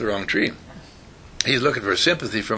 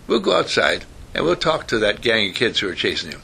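ordinary talk, nothing marked

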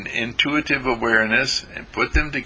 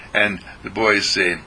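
ordinary delivery

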